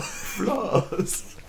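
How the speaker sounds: put-on high, drawn-out German-accented word; mock German accent. Repeating it for a laughing friend, mock German accent. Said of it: "the German has just come out".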